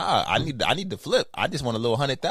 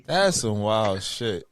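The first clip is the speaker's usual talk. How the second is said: stunned disgust; 6 semitones lower, much slower than usual. Real, not acted. Slow disgusted reaction.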